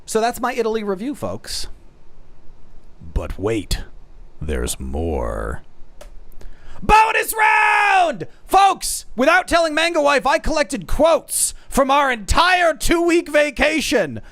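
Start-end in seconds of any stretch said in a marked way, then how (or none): 3.1-5.6 very deep announcer-style tease; dramatic teaser. Pretend. Streamer teases more after his review, deep dramatic voice. 6.8-14.2 high, drawn-out shout into excited reveal; hyped announcer. Pretend. Streamer reveals secretly collected wife quotes, hyped announcer shout. Game-show style bonus announcement.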